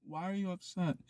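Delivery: very soft, soothing question; calm. Acted. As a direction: meet the screaming with a hushed voice. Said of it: "it's with that calmness".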